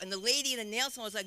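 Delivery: usual speaking voice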